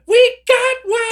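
very high, loud, drawn-out holler; full-voice scream. Shown as a demonstration. Host demonstrating the phone challenge, screaming it out. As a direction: scream it at the top of your voice. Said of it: "scream at the top of your voice".

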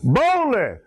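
"Boldly" pitched high with a firm fall; bold emphasis. Acted. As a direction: proclaim "Boldly" with conviction. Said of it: "they said it with some enthusiasm".

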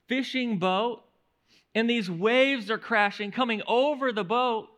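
usual speaking voice

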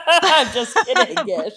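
laughing through it. Real, not acted. Laughs while saying it.

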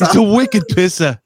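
high, hammed-up New England accent; mock the ex's New England accent up high. Mimics the New England girlfriend.

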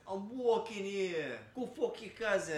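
high, slow, loud mock New York accent, laughing; a loud, slow mock New York shout; New York yell. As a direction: bellow it like an angry New Yorker. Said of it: "y'all yell".

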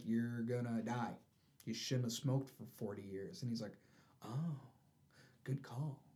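low, quiet, understated verdict; soft, deflated delivery; deadpan retelling. Retold or imitated. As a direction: drop the voice for the bad news. Retold doctors' verdict and meek reply.

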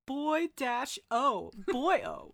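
put-on BOY DASH O slow, high and loud, then BOYO; emphatic spelling-out. Shown as a demonstration. Host spelling out the name, "Boy dash O. Boyo." hammered.

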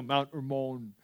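Mount Hermon with a silent h, HER-mon becomes er-MOAN; Hebrew pronunciation. Shown as a demonstration. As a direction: Hermon said the Israeli way, soft er-MOAN.

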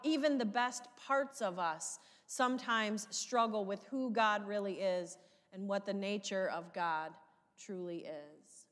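normal talking voice

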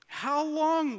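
loud lament. Acted. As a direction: cry out to God. Reading Psalm 13 lament.

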